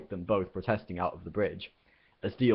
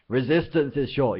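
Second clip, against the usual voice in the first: loud, higher bellowed slogan; 7 semitones higher, 5 dB louder than usual. Acted. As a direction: fill the lungs and roar it. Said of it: "filled his lungs and bellowed".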